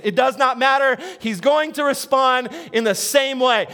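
usual speaking voice

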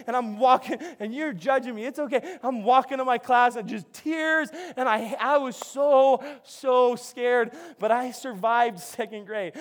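ordinary delivery

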